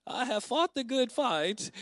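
high, slow, drawn-out impression; Thelma. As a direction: affectionately imitate an older friend's distinctive voice. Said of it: "saying that in her voice, in the Thelma voice".

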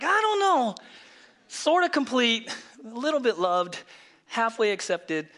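high, wavering reluctance sinking line by line; doubtful mock believer. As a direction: imitate a hedging, half-convinced churchgoer. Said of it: "some of you are still like".

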